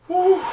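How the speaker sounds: high whooping woo; high cheer. Real, not acted. Vlogger whooping at the console, high and happy.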